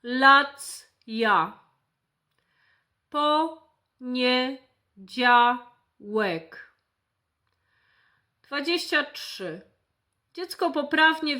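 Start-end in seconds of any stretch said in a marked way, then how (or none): 0.0-6.7 slow, each word drawn out syllable by syllable; slow syllable demo. Shown as a demonstration. Therapist showing a child how syllables join, said slowly. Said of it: "gdy dziecko łączy sylaby".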